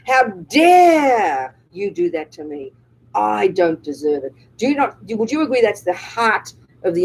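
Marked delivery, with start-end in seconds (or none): 0.1-4.3 slow outraged shout. Shown as a demonstration. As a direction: stretch out "How dare you" in outrage. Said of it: "that's especially".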